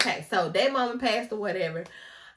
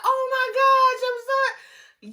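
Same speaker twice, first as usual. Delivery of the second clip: high, loud oh my gosh, fading slower on the apology; friend's high shocked cry; 10 dB louder, slower than usual. Retold or imitated. Kayla reacting to the positive test, high and shocked. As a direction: squeal it out in shocked disbelief. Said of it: "she was like".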